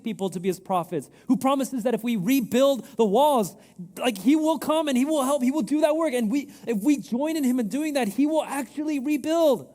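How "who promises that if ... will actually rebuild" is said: preaching. Acted. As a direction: preach it with full force. Preaching climax.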